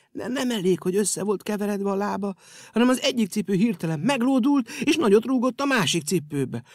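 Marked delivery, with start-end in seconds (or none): none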